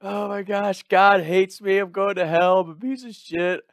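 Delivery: high, loud hammed-up whine; over-the-top self-pity, wailed high; mock crying. Imitating himself crying to his friend, high loud whine. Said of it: "I'm crying like".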